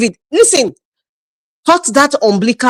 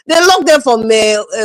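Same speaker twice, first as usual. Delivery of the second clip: normal talking voice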